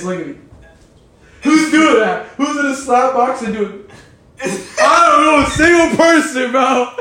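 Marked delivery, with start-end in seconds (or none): 1.4-3.8 mocking outburst. Real, not acted. Mocking a guard stance. 4.8-6.9 high, loud disbelieving shout; loud incredulous shout. Real, not acted. Ranting about the stance.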